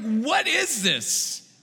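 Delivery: slow, drawn-out disbelief; amazed. Retold or imitated. Pastor mimicking his delight at fast delivery, slow amazed question. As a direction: stretch the question in wonder. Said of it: "I'm like".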